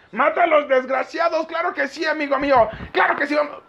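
high, strained eager cries; hammed-up gung-ho squeal; worked-up frenzy. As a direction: get carried away, voice pitched high. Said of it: "perdón, güey, me alteré".

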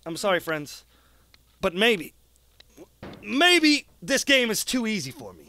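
ordinary delivery

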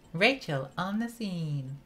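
imitating a game heroine's catchphrase; Rachel. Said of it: "I'm Rachel".

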